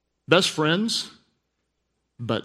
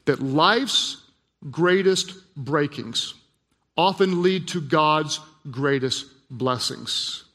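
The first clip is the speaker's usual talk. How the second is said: slow, raised, emphatic repeat; deliberate preacher's repetition, weighing every word; letting it sink in; 3 semitones higher, slower than usual. Real, not acted. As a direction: pause between phrases so each one lands. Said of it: "I just want it to just sink in".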